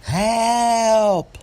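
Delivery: high, drawn-out scream; screaming for help. A narrator's reading. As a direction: scream it long and piercing, like a terrified old woman. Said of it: "she jumps up and screams".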